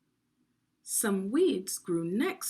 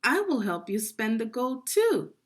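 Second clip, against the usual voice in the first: louder, higher greedy offer; eager middle brother; 6 semitones higher, 6 dB louder than usual. Acted. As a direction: jump in to grab a share. Middle brother wants the gold.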